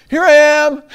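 loud, high sing-out call. Acted. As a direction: announce yourself at full voice, high. Showing up, announcing himself to God.

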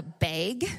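"bag" drawn out with a long A, like "bayg". Shown as a demonstration. Wife mimics her husband's word, long-A "bag". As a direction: say "bag" to rhyme with vague. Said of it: "he says bag".

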